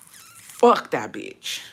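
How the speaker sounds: slow, low drag on each word; slow drawn-out. Shown as a demonstration. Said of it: "Sorry, it didn't come out the way I wanted to".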